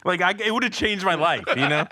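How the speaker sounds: ordinary talk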